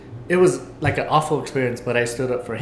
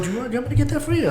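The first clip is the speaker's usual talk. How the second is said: much higher, quick, over-eager attendant voice; eager flight attendant; 12 semitones higher, much faster than usual. Retold or imitated. Retelling how the attendant offered help, eager high voice. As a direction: play the fussing attendant, rushing to help. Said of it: "He's like".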